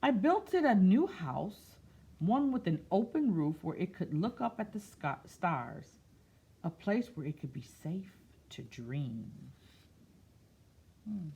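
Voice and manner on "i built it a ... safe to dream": slow storybook read-aloud; picture book voice; reading to children. Acted. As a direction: read it gently to a class.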